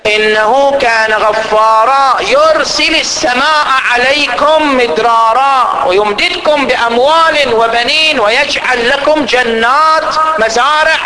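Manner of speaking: slow, measured chanted recitation; Quran recitation. Acted. Preacher reciting the promise of rain and gardens, slow chant. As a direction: chant the verses slowly, phrase by phrase.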